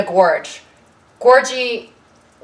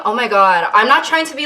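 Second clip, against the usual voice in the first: ordinary talk, nothing marked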